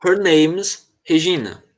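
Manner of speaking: very slow, careful teacher read; very slow model line. Shown as a demonstration. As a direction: spell it out slowly for the student. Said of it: "Aí continua". (leitura bem lenta e pausada)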